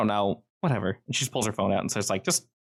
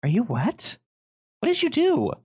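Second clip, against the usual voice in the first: put-on high-pitched, incredulous girl character; acted high-pitched questioning; 12 semitones higher than usual. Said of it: "she looks at Lee and goes".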